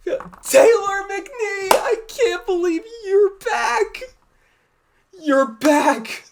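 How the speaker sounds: high, overjoyed squeal; high-pitched fangirl cry of joy. Real, not acted. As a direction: wail it with tearful delight. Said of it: "me just screaming like a little girl".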